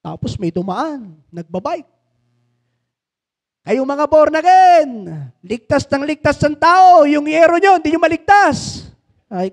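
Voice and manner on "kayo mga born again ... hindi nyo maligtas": loud mocking taunt. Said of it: "ang lakas pa ng boses".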